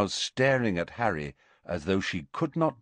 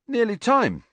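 high, airy and carefree; blithe; 14 semitones higher, 4 dB louder than usual. A narrator's reading. Harry to Ron before the match, blithe. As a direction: act like nothing happened. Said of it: "said Harry blithely".

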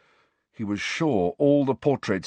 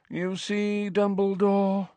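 a lightly voiced, high and knowing drawl; sly; 10 semitones higher, slower than usual. A narrator's reading. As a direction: tease him with a higher put-on voice. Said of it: "said Phineas Nigellus slyly".

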